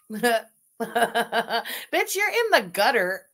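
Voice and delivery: slow, drawn out through laughter; laughing tease. Real, not acted. Teasing a co-host about her looks, slow and laughing. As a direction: stretch it out while laughing at her.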